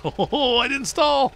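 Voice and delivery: high, excited outburst breaking into laughter; excited relief. Real, not acted. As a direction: cry out in surprised relief, laughing. Truck nearly stalls, he cheers.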